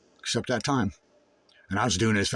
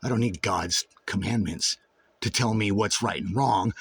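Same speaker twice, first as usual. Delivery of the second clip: ordinary talk, nothing marked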